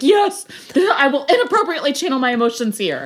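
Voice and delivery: high, laughing mock declaration; mock dramatic. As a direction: announce it with grand mock drama. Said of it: "I'm like".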